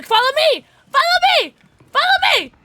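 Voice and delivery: very high, loud shrieked command; urgent shouting. Real, not acted. Player urging someone to come along, high frantic shouts. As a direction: shriek the order over and over.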